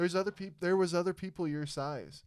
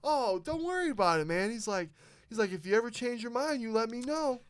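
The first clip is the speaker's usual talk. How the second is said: much higher, kind reassuring coach; coach's voice; 8 semitones higher, 5 dB louder than usual. Retold or imitated. Kind coach letting the kid off the hook, high and friendly. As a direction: lift it up high and easy like a nice coach. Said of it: "He goes".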